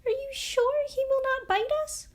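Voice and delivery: high, small, nervous mouse voice; timid voice. Acted. Mouse queen peeking out of the grass, timid. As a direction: ask it hesitantly, ready to flee. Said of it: "asked in a timid voice".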